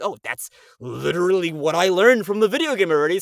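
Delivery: loud, high burst of discovery; excited realisation. Retold or imitated. Actor realising game work fits the role, excited outburst. Acts out happy realisation about accent.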